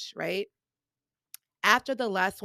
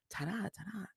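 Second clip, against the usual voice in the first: much softer, puzzled repeating of the word; puzzled murmur; 13 dB quieter than usual. Retold or imitated. Confused audience repeating an unfamiliar term, soft and puzzled. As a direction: mutter it to yourself, baffled.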